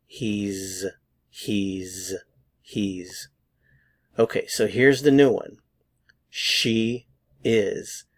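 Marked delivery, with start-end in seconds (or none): none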